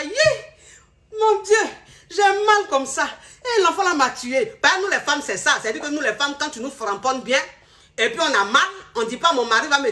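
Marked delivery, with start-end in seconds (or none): 0.0-4.6 woman crying out. Retold or imitated. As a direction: ham up the wife's yelp. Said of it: "elle crie comme ça".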